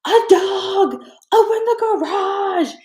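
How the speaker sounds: high, loud yell across the house; shouting to husband to open the garage, loud and high; screaming for help. Retold or imitated. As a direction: scream it out to your partner as you run. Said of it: "I scream to James".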